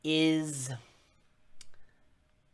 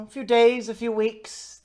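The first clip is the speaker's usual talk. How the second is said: higher-pitched, hesitant-sounding stranger; stranger's spoken answer; 9 semitones higher, 7 dB louder than usual. Acted. Game master as the stranger answering how long, higher in-character voice. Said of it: "and he says".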